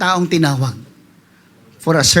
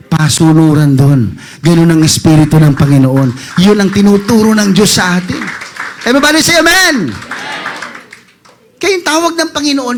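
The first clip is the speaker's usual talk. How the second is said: full-force preaching; 5 dB louder than usual. Real, not acted. Pastor rousing the congregation to say amen, loud preaching.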